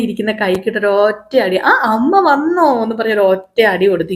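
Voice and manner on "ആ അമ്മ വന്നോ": high bright greeting, a light mock child voice; cheery girl. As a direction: chirp it like a happy kid.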